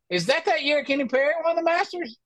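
high teasing voice; teasing mock question. Friends teasing on Twitter, asking in a mock high voice. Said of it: "will be like".

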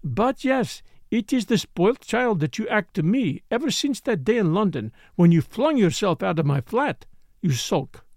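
a hint of French, pouting at him; lightly French-accented Morel. Morel's voice held.

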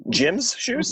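incredulous. Real, not acted. Mocking disbelief.

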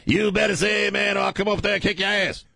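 high, fired-up preacher threat; hammed-up preacher voice; radio preacher. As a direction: chant it like a revival sermon. Back in the preacher impression.

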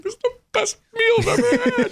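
very high-pitched mock sobbing squeal; mock tearful. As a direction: blubber it in a comically high voice. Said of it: "I was actually crying at the table".

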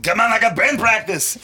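high, loud put-on excuse; mock protest. Joe brushing off her invitation, high mock protest.

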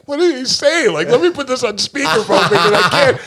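high, flustered, laughing through it; laughing panic. Retold or imitated. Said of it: "And I'd be like to the manager".

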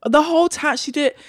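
ordinary delivery

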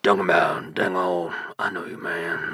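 slurred, run-together mumble of a cartoon character; loose, barely intelligible rambling impression; Boomhauer. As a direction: mumble the words into one another. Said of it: "Boomhauer from, uh, King of the Hill".

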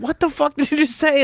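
shocked disbelief. Retold or imitated. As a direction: replay the moment you heard the bad news. Retold shocked reaction to camera.

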